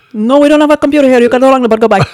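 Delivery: put-on loud, high Indian accent, laughing. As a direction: fire the accent right back, laughing. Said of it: "I just give him the same accent right back".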